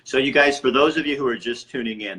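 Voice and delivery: calling out to viewers. Real, not acted. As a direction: grab the viewers' attention again. Addresses livestream viewers.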